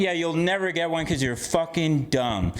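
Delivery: ordinary delivery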